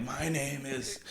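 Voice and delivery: high, soft, strained mock menace; mock choking threat. Choking a friend over the name, mock threat. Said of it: "choking you, like".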